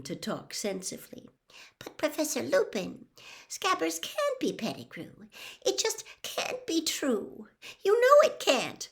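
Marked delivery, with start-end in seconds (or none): 1.8-8.9 trembling, would-be calm. A narrator's reading. Hermione willing Professor Lupin to talk sensibly, trembling. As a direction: plead for sense, pitched high, trying to stay calm. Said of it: "in a trembling, would-be calm sort of voice".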